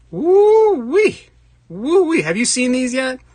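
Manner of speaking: loud, high-pitched whooping; excited whoop. Real, not acted. Streamer showing viewers his new knife, excited whooping. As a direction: whoop with delight at a prized find.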